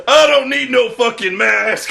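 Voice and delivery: mock tough-guy yell; hammed-up colonel shout. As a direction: snap it like a reckless Marine. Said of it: "He's like".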